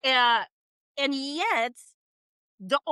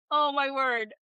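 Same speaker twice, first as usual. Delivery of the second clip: ordinary delivery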